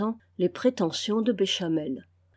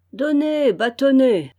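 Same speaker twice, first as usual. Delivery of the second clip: imperturbable; 5 semitones higher, 7 dB louder than usual. A narrator's reading. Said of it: "répondit imperturbablement Lapierre".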